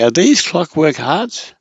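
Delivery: tongue-in-cheek high rising question on "hearts"; questioning inflection. Shown as a demonstration. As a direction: ask in mock disbelief about "clockwork hearts". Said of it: "you can say".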